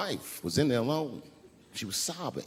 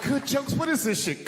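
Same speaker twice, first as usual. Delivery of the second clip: high, loud scornful shout; angry yell; 11 semitones higher, 4 dB louder than usual. Retold or imitated. Wife furious at the joke book, shouting. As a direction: snap back in disgust.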